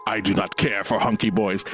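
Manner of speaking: a lightly voiced dad, dry and dismissive; deadpan movie dad. Retelling the dad refusing the catalogs, deadpan low voice. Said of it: "He's like".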